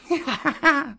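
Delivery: high amused chuckle of the python; a knowing little laugh; snake chuckle. A narrator's reading. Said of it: "said Kaa with a chuckle".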